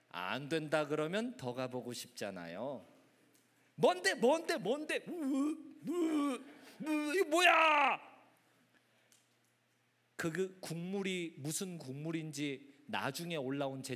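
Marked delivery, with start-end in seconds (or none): 3.7-8.0 higher eager questions building to a loud cry; pry eagerly, then recoil in mock disgust; nosy then grossed out. Acted curiosity, then disgusted discovery.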